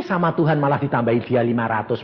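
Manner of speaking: ordinary delivery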